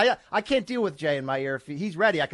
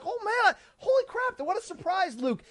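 squeaky delighted "holy crap", pitched way up; mock surprise; 12 semitones higher than usual. Husband surprised the double date turned out fun, higher-pitched delighted gush. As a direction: gush like the evening turned out great.

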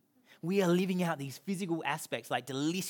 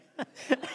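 short laugh; 8 semitones higher than usual. Real, not acted. Laughing at own story.

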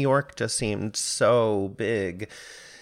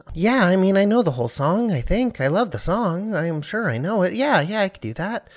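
higher and louder, nervous rush of reassurance; flustered; 8 semitones higher, 5 dB louder than usual. Retold or imitated. Nervous young singer assuring the casting director he knows the song. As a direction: bluff through the panic, a touch of nerves. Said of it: "I didn't know what to do".

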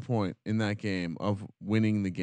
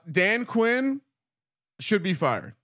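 ordinary talk

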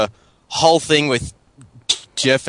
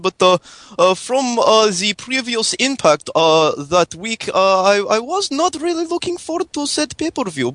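higher-pitched mock Russian accent with broken English; fake Russian accent; 5 semitones higher than usual. Prank caller phoning hosts in fake Russian accent. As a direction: play a hammed-up Russian caller. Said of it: "was a good Russian accent".

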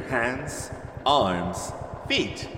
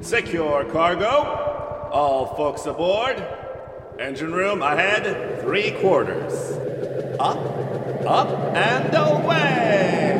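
slow, louder captain's orders; commanding launch call; 4 semitones higher, 4 dB louder, slower than usual. Acted. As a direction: call the launch, building to a cheer.

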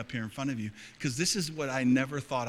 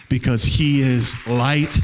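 much louder, slow, ringing; triumphant proclamation; 14 dB louder, slower than usual. Real, not acted. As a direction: declare it to the whole hall. Sermon climax.

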